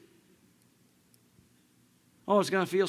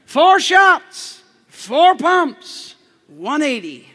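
much louder, higher shouted call; shouted order; 6 semitones higher, 12 dB louder, much slower than usual. Shown as a demonstration. Preacher leading the congregation to shout his coffee order. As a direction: belt it like a rallying cry. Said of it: "Everyone say that".

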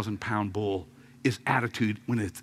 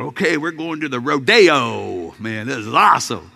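excited; 8 dB louder than usual. Retold or imitated. Excited about going to rodeo.

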